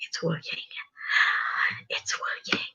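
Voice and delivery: relieved whisper. Real, not acted. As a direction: whisper it to yourself. Quietly checks stream.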